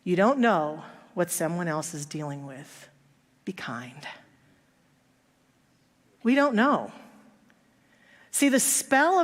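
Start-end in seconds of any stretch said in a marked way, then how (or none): 3.4-4.1 lower, soft whisper; whispered plea. Real, not acted. Preacher whispering the lesson to the congregation. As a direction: breathe it as a heartfelt plea.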